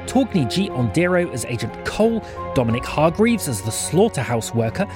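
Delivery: ordinary delivery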